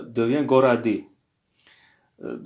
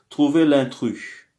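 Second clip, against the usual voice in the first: ordinary talk, nothing marked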